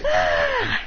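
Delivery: long, very high acted scream; mock scream. Kakashi jumping in the air, screaming. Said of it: "Kakashi screams as he jumps".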